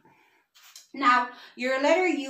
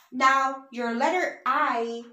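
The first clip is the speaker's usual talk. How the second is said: ordinary talk, nothing marked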